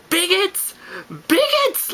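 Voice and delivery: very high-pitched held-back yell; mock drunken yelling. Bawling drunk yelling at bottle throwers, imitated high yell. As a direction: wail it out in a hammed-up high voice. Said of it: "I literally just start yelling".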